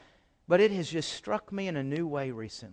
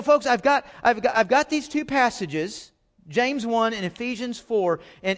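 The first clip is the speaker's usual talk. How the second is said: ordinary talk, nothing marked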